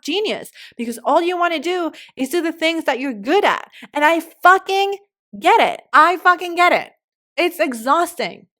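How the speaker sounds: loud, high, impassioned rant; worked up, voice pushed high and loud; fired up. Real, not acted. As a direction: hammer it home with real heat. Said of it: "getting so fired up".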